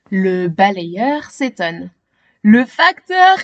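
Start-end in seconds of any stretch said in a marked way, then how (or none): none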